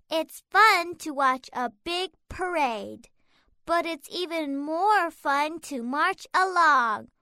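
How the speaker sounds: ordinary delivery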